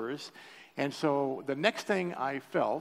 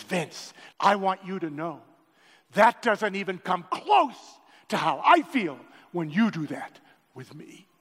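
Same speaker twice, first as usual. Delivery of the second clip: God speaking; 5 semitones higher, 8 dB louder, slower than usual. Retold or imitated. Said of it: "And he says".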